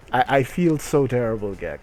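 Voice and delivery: ordinary talk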